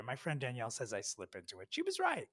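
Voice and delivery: ordinary talk, nothing marked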